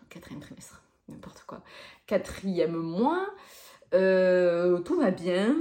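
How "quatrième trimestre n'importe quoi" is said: whispered aside to herself; whispering. Real, not acted. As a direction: drop to a whisper for the correction. Whispered self-correction aside.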